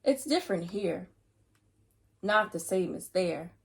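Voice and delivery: story narration. A narrator's reading. Reader reads the book aloud to children, steady storytelling voice. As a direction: read each line with care.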